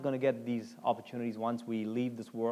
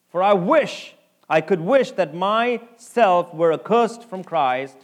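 louder, slow, pleading sermon line; preaching at full force; 5 semitones higher, 11 dB louder, slower than usual. Acted. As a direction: lean into the verse as a plea. Paul's anguished wish, preached out.

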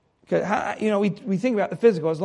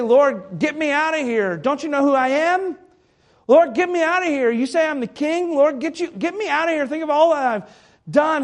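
higher and louder, bossy demanding plea; entitled demand; 7 semitones higher, 5 dB louder than usual. Pretend. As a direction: demand it like a spoiled king. Demanding prayer of entitled king.

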